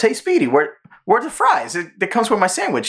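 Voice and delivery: high, lightly voiced Daffy complaining; a hint of cartoon duck demanding fries. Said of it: "He goes".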